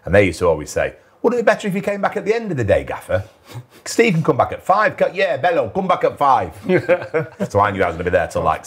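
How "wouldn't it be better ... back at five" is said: mocking teasing coaches. Coaches teasing, setting him up.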